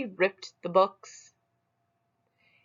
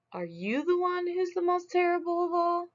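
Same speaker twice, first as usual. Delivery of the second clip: much higher, odd-voiced demand; high question; 12 semitones higher than usual. Smug asks the second beast in a much higher voice. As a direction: ask it up high and strange.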